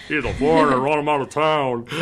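loud, lightly acted angry local; mock hostile townsfolk. Imagined Richmond locals driving out the newcomer, loud mock outrage. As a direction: play a townsperson raising the alarm, half joking.

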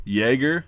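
Jäger as YAY-grr, rising, with American R; American pronunciation. Shown as a demonstration. Advising Americans what will be understood, Jäger with Y and hard R. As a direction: sound the final R in Jäger. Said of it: "as an American, they'll understand you".

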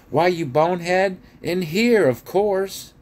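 high, loud scornful dwarf voice; exasperated, high-pitched character retort. A narrator's reading. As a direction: snap back as if it's obvious. Dwarf insults Edmund in story.

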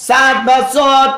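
very slow, each form set apart: সাদ বা সদ; slow contrast. Shown as a demonstration. Teacher slowly contrasting wrong and right. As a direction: lay out সাদ against সদ slowly. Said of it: "সাদ বলি".

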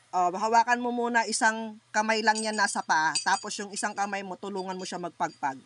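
ordinary delivery

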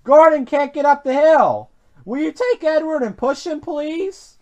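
high-voiced porter calling for help; urgent request. A narrator's reading. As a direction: start loud, fade to a high plea. Porter asks driver for help.

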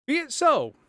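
high, loud voice of a man giving in; unmasked prisoner facing his fate, high and loud; resigned prisoner. A narrator's reading.

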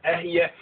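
"Ehya" said as eh-hee-ya; pronounce "Ehya". Shown as a demonstration. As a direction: say "Ehya" in three syllables, eh-hee-ya. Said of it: "pronounce it".

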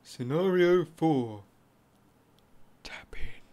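slow unvoiced title whisper; ASMR whisper.

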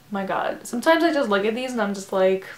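ordinary talk, nothing marked

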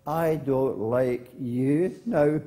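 slow, drawn-out echo. Acted. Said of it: "And the voice came back".

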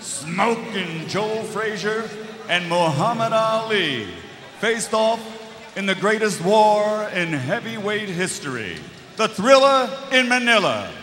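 booming boxing ring announcer. Acted. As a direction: build it up like a big fight intro.

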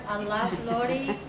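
ordinary talk

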